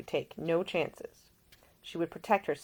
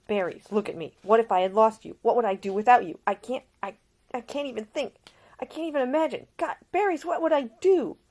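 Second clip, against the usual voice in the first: higher, louder desperate man; distraught Nick; 7 semitones higher, 7 dB louder than usual. Acted. Nick to Ida, fearing her loss, desperate and higher. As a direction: plead from feverish fear of losing her. Nick's feverish fear of loss.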